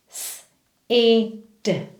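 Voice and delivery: S, E, D said as separate sounds; sounding out words. Shown as a demonstration. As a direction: sound out S, E, D one at a time. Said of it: "I'm going to sound out each word".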